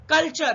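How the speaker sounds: कल्चर with T sounded as CH. Shown as a demonstration. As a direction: demonstrate the CH in कल्चर. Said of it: "उसका उच्चारण हम चा करेंगे".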